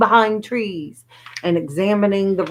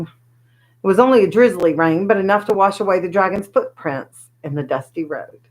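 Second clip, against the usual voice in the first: ordinary delivery, nothing marked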